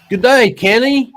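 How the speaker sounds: a Yank straining to sound Aussie; forced Aussie accent. Pretend. American guest tries an Aussie hello for the host. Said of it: "was it a little forced".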